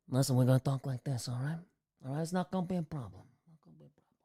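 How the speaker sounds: put-on a touch of a New Jersey accent, tough guy. To chat, a mild New Jersey tough-guy accent. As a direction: talk it like a Jersey wise guy, lightly. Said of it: "How'd you nail the accent for Rogers?".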